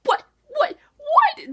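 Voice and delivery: a repeated what, the last one shooting high and loud; stunned, each what climbing; rising shocked whats; shocked disbelief. Retold or imitated. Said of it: "and I was like".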